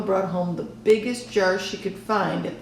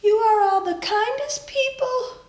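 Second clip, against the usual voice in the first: grandma's speech; 3 dB louder than usual. A narrator's reading. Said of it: "my grandma made a speech".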